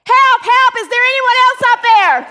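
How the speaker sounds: high, loud, hurried shout; yelling for help. Acted. Hiker ignores God and calls for someone else, yelling. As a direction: yell past God for anyone at all.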